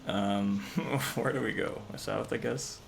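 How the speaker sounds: usual speaking voice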